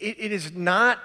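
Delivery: usual speaking voice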